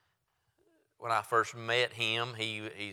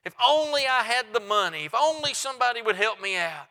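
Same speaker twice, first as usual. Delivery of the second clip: loud, driving pulpit delivery; full-force revival sermon voice; fervent preaching; 11 semitones higher, 6 dB louder than usual. Acted. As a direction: preach it hard to the congregation.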